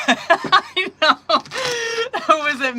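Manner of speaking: high laughing relief, almost crying. Real, not acted. Relieved after embarrassing phone call.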